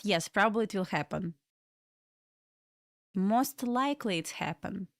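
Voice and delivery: ordinary talk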